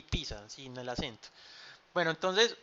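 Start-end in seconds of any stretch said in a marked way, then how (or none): 0.0-0.5 "pizza" as PEE-sa, with no k; pizza said as "pisa". Shown as a demonstration. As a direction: softly say "pizza" as PI-sa. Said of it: "se pronuncia así".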